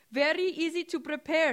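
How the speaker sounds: put-on high, loud Indian shopkeeper accent. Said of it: "she says in her strong Indian accent".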